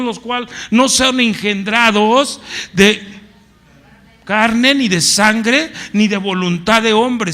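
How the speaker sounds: loud, high, ringing proclamation; fervent preacher proclaiming John one, loud and high; preaching at full force. Real, not acted. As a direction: declare the verse to the whole church.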